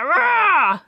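bratty growl. Shown as a demonstration. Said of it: "really get that".

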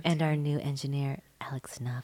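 soft, hushed ASMR intro; ASMR whisper. Shown as a demonstration. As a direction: murmur it close and low. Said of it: "we're gonna do A S M R versions".

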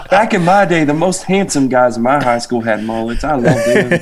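put-on Southern mom accent. Mom reminiscing about high school mullets, mock Southern accent. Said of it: "gave your mom a Southern accent when I was impersonating her".

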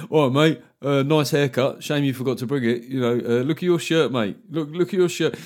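cheeky geezer ribbing a punter; lightly voiced blokey banter; comic imitating crude club stand-up to an audience member; mock laddish heckler. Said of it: "just go up and go".

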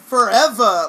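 loud, theatrical single word; dramatic gif imitation. Host imitates the gif's dramatic spin, mock dramatic. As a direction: belt it out like a dramatic reveal. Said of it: "and the dramatic spin".

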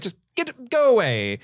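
halting, imitated shooing; Carrie Elwes. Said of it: "Carrie Elwes walks up and is like".

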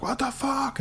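mock outraged yell. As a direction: blast it, furious and high. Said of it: "You're like".

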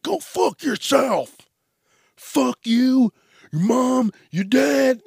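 high, loud mock tirade; hammed-up screaming customer; slow then rapid shrill cussing at the cashier.

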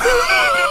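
sharp high laugh bursting out; burst of laughter. Real, not acted. Laughing at the absurd memory.

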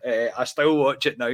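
usual speaking voice

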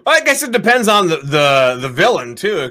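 ordinary talk, nothing marked

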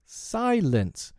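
high-pitched cry for order. A narrator's reading. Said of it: "Wendy cried".

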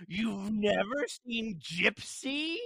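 mock incredulous. Mentor scolding about classic films.

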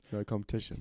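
low, laughed-out aside; laughing. Real, not acted. Joking about being first on the list, laughing. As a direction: say it through a chuckle.

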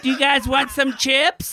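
high, slow call down the stairs; mom yelling down. Retold or imitated. Mom calling down to the basement, high and slow. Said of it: "His mom keeps yelling down".

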